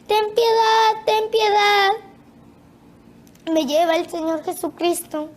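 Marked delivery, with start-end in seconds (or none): 0.1-1.9 high, sustained imitated plea; retold cry for mercy. Tormented crowd begging for mercy, imitated high cry. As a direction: call out pitched up, short of a real shout.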